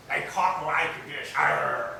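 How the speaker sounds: slow, loud pirate talk. Shown as a demonstration. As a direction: growl it like a pirate. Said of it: "the pirates speak".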